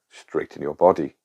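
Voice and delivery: ordinary talk, nothing marked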